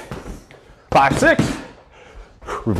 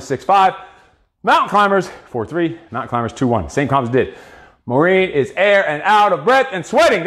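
ordinary delivery

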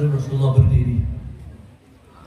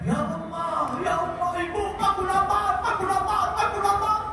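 put-on high, soft crying child's wail; baby wailing, aku lapar; wailing hungry baby; 6 dB quieter than usual. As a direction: cry for your mother like a starving infant. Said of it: "Teriak-teriak".

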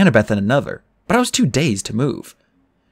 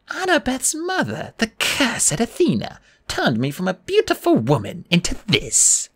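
Medusa; 11 semitones higher than usual. A narrator's reading. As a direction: plead for sympathy in a high voice. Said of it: "the poor old grandmother".

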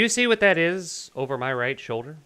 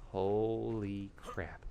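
hushed, slow, low wonder; awestruck; 7 semitones lower, 11 dB quieter, much slower than usual. Real, not acted. Gamer taking in the cave, awestruck. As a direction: draw it out softly in awe. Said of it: "I'm just awestruck right now".

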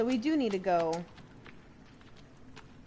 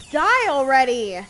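angry shout; 10 dB louder than usual. Real, not acted. Fighting a monster.

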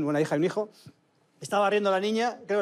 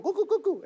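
high, laughing cuckoo-clock call; 11 semitones higher than usual. Retold or imitated. Telling friends about the clock, a laughing cuckoo imitation. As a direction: chirp it like the clock's bird. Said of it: "sale un cuco".

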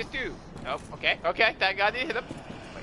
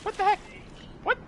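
startled high shriek. Real, not acted. Startled by hidden enemy.

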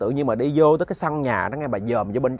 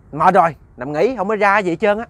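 higher, louder, brusque brush-off; irritated refusal; 6 semitones higher, 5 dB louder than usual. A narrator's reading. Old woman refusing to come out, irritated. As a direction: send the visitor away testily.

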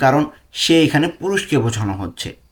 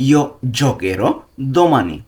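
Io giocherò domani said very slowly, each word spaced; slow dictation; much slower than usual. Shown as a demonstration. Teacher models the sentence slowly for learners, careful pace. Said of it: "Italian কি হবে?".

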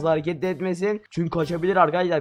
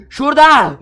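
shouted find; 9 semitones higher, 11 dB louder than usual. Real, not acted. Spots a tree.

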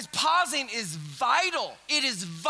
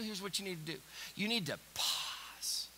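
whisper; 4 semitones lower, 10 dB quieter than usual. Acted. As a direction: whisper it like a secret. Drops to a whisper.

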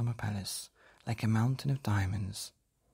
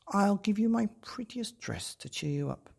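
high, gentle princess offering comfort; a kind princess consoling a girl; 10 semitones higher than usual. A narrator's reading. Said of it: "said the princess".

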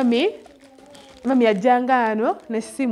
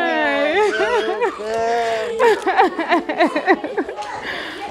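long, high burst of laughing; real laughter; 7 semitones higher than usual. Real, not acted. Greeting the children on a visit, long high laughter. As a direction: laugh helplessly, pitched high.

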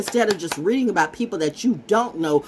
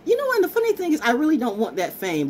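ordinary delivery

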